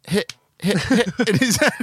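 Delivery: very high, breaking into laughter; squeaky-high mock whacks with a laugh; laughing re-enactment. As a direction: act out the hits through laughter. Re-enacts the blows again, laughing.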